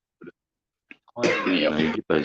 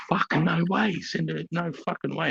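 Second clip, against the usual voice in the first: emphatic refusal; 12 semitones higher, 3 dB quieter than usual. Retold or imitated. Acted refusal to take her along.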